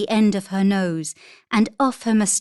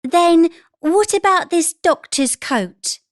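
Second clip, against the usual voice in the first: higher, louder, eager mum; excited mother; 9 semitones higher, 5 dB louder than usual. A narrator's reading. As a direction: enthusiastic mother showing costumes. Mother voiced in story.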